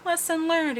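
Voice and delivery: high-pitched imitated whimper; lightly acted tearful voice; mock near tears. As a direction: squeak it out as if almost crying. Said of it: "almost crying".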